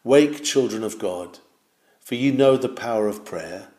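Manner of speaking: ordinary talk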